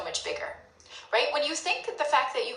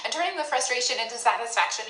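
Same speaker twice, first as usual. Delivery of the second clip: ordinary talk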